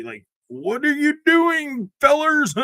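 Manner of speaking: high, slow mocking yell at the fellers; drawn-out mock outrage, shouted at the end. Mock quote of outraged worker.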